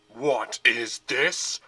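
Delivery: slow, loud roar. A narrator's reading. General at the kitten, a slow loud roar. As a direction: roar in disbelief. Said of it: "the general roared".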